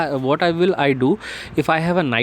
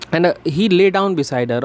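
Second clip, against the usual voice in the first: slower, higher read; 4 semitones higher, much slower than usual. Shown as a demonstration. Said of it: "rereads line slower, higher".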